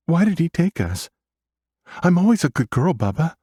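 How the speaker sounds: put-on very high, pleading child voice; upset little girl. A narrator's reading. Little sister asks brother, near tears.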